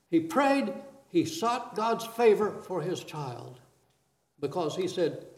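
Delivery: usual speaking voice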